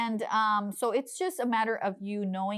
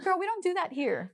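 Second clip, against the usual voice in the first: sassy; 11 semitones higher than usual. Retold or imitated. Sassy retort to gangster customer.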